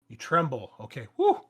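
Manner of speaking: usual speaking voice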